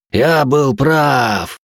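slow, high, loud exultant shout; gloating triumph. Acted. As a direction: crow in mad triumph. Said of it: "Свихнувшийся стальной ликовал".

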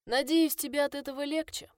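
high, loud mocking sneer; smirking. A narrator's reading. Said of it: "усмехнулся он".

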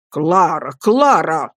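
stern husband calling his wife to order; slow, falling reprimand on the name. A narrator's reading. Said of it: "строго проговорил мистер Мордстон". (строго, с упрёком)

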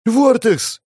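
high-pitched mock cry of relief. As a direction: call out a name, high and urgent. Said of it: "Она вскрикнула".

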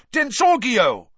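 high, loud screamed curse; screamed spell. A narrator's reading. Malfoy firing a hex at Harry, screamed high and loud. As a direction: shriek the hex back. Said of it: "screamed Malfoy".

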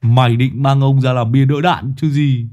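annoyed retort. Acted. Lâm Sẹo glaring back at Đồng Già, irritated and sharp. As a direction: snap back at the coward. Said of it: "Lâm Sẹo trợn mắt".

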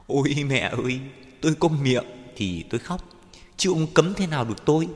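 high, loud lament turning to protest; aggrieved high-pitched outcry; defiant wail. Acted. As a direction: wail back in grief and defiance. Mourner defies bullying official through tears.

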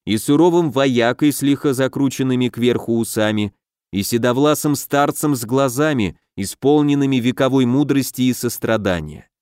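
usual speaking voice

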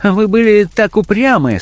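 high-pitched, uneasy character voice; nervous fear. A narrator's reading. Blackmailer excusing himself to his victim, afraid. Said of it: "в смехе его послышался страх".

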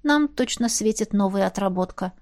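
ordinary delivery

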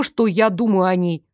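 ordinary delivery

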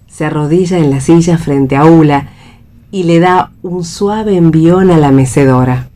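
normal talking voice

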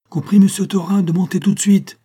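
high, loud call down a corridor; urgent, far-carrying summons; shouting. A narrator's reading. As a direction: shout the order to come up at once. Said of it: "cria-t-il".

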